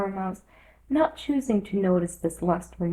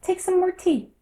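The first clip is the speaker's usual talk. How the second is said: earnest; 11 semitones higher, 4 dB louder than usual. A narrator's reading. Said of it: "said to Alice very earnestly".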